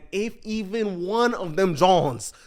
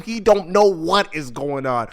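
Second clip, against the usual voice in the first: usual speaking voice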